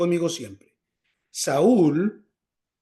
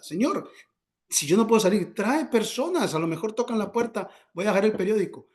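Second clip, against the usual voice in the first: higher, eager petition; acted pleading prayer; 6 semitones higher than usual. Housebound believer asking the Lord for visitors, high acted plea. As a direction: plead upward with lifted pitch.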